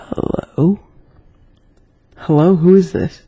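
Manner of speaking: anxious, rising hello; unsettled caller, voice tightening; nervous. A narrator's reading. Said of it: "I said nervously".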